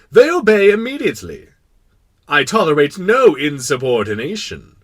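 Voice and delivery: loud, high, imperious king; absolute monarch. Acted. King boasting of the stars' obedience, imperious. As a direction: proclaim it, allowing no dissent.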